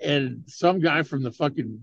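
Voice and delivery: ordinary delivery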